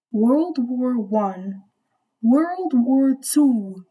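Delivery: very slow, drawn-out emphasis; dramatic listing. Real, not acted. Storyteller listing wars the older lady lived through, slow and dramatic. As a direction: stretch out each war's name for weight.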